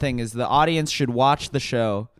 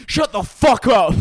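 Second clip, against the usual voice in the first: high, loud, drawn-out bellow; mock angry yell; 9 semitones higher, 8 dB louder than usual. Comic and his boys rejecting a coffee offer, mock yell. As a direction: yell it as a slow, hammed-up rebuff.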